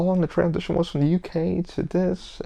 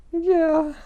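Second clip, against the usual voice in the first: sarcastic high yeah; 13 semitones higher than usual. Real, not acted. Answering his own rhetorical question.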